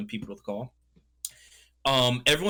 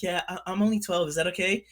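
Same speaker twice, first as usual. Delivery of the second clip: man doing a woman's voice; 6 semitones higher than usual. Retold or imitated. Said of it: "[name] just talking like a girl".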